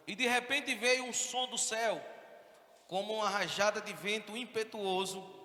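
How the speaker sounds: high, loud, sung-out preaching; fervent scripture reading. Acted. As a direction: proclaim the verse over the music. Reads Acts 2 over music. (leitura inflamada da Bíblia)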